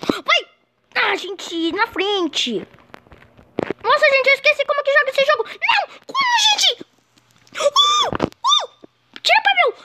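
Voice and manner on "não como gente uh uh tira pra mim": high frantic yelling; frantic shout. Real, not acted. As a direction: yell at the screen in panic. Near miss, frantic.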